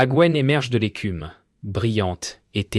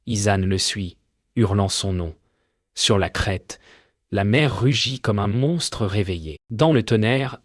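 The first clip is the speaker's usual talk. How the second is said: ordinary delivery, nothing marked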